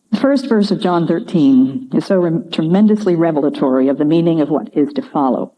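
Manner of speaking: ordinary talk, nothing marked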